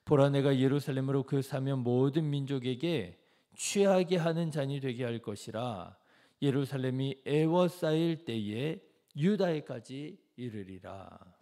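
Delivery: loud, declamatory Bible reading; scripture reading. Acted. Reads the verse aloud.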